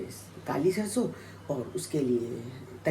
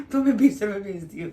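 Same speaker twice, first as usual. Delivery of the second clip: laughing tease; 5 semitones higher, 5 dB louder than usual. Retold or imitated. Teasing son about sister's day.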